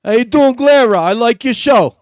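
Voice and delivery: loud, high, hammed-up New York accent greeting. Reading a viewer's super chat to Glera, in a New York accent. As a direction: belt it out like a brash New Yorker in a New York accent. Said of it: "I wanna say this in my New York accent".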